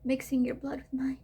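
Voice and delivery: grieving whisper. Acted. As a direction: whisper it like a loss. Mourning the child.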